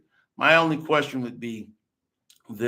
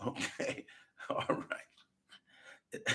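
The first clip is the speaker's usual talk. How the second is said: breathless laughing okay, all right; 15 dB quieter, much slower than usual. Real, not acted. Laughing at his own point to viewers, laughing. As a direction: laugh the words out under the breath.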